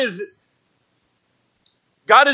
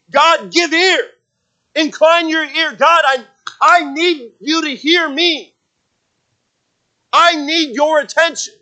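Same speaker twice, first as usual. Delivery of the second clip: louder, higher, insistent plea to God; raised, emphatic appeal for full attention; admonishing plea; 7 semitones higher, 5 dB louder, slower than usual. Acted. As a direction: press each phrase urgently, demanding to be heard. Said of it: "he's actually admonishing God".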